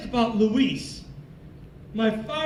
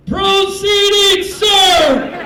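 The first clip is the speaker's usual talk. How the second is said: full-force high, drawn-out shout; very loud, slow parade-ground yell; military holler; 7 semitones higher, 12 dB louder, much slower than usual. Retold or imitated. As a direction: bellow it across a crowded shop. Airman hollers across the shop.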